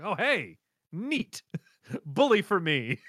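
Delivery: lightly British pleased hero; a hint of a posh English JoJo, high on neat; mild mock British cheer; Jonathan voice. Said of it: "you're giving JoJo this Jonathan voice".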